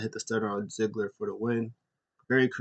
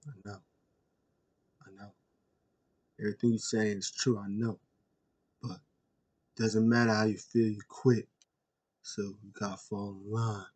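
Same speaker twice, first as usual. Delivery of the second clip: calm Roman; 2 semitones lower, 5 dB quieter than usual. Retold or imitated. Roman calmly telling Jey he quit, soft and cool. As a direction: stay cool and quiet, unbothered. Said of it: "Roman calm as a cucumber".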